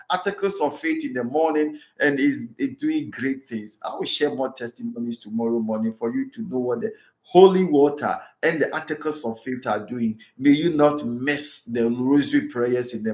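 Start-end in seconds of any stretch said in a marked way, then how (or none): none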